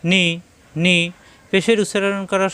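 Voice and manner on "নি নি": নি pressed slightly downward, firm; firm downward pressure. Shown as a demonstration. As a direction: press the vowel of নি lightly down. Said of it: "একটু নিচের দিকে চাপ সৃষ্টি হবে".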